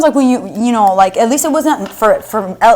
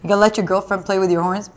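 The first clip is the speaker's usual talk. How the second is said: ordinary talk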